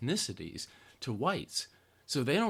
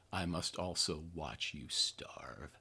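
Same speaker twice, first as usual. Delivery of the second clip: sinister whisper; 6 semitones lower, 7 dB quieter, slower than usual. Acted. Sinister quote of conservative mindset.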